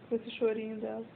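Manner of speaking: normal talking voice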